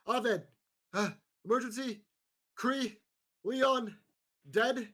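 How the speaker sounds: loud, high, gasped-out emergency report; panicked guard shout. Acted. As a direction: burst in shouting, word by word between breaths. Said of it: "bust in and shout".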